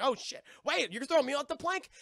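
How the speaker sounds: high, loud mock disbelief; hammed-up sudden panic; alarmed realisation. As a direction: rise into a startled question. Jack acted realising his fate.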